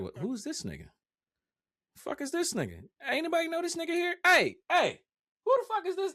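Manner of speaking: high, incredulous outburst; mock bewilderment. Creator acting out viewer baffled by the live streamer, incredulous. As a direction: call out in exaggerated high-pitched disbelief.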